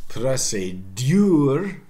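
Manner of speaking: "procédure" as pre-say-DYOOR; slow, loud ending on "procédure"; y-glide and final stress in "procédure"; Middle English pronunciation. Shown as a demonstration. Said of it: "in Middle English".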